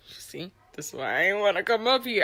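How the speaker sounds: half-whispered complaint to aunt; whispered aside. Retold or imitated. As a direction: whisper it sideways, as a private grumble. Muttered aside to her aunt.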